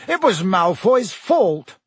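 high, slow, emphatic retort; snapped. A narrator's reading. Dean defending Hagrid to the Slytherins, snapping. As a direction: snap back, leaning on each word. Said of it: "snapped Dean Thomas".

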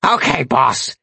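croaky demon servant calling out; raspy imp yell. A narrator's reading. Imp calling to his master on the run, raspy. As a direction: rasp it out as an obedient imp. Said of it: "he yelled".

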